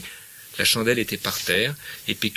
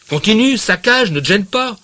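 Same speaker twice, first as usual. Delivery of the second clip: very high, loud furious outburst; furious shout; 11 semitones higher, 11 dB louder than usual. Acted. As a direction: scream at him for breaking the cup. Acted quarrel, Bouvard turns pale.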